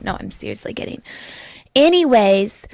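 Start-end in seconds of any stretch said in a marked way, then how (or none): 0.0-1.0 breathy aside. Real, not acted. Aside after joke.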